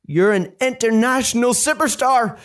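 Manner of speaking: high-pitched, rising amazement; amazed. A narrator's reading. Boy telling his mum of her fame, amazed. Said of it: "he gasped in amazement".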